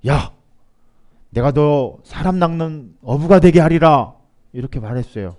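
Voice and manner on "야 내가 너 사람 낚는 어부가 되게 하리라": loud, slow, commanding call. Acted. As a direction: summon someone with a drawn-out proclamation. Acts out Jesus suddenly calling someone. (크고 느리게 선포하듯 부르는 소리)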